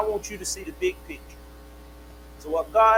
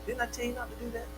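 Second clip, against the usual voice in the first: voice of God scolding; 4 semitones higher, 11 dB quieter, faster than usual. Acted. God scolding Abram in sermon.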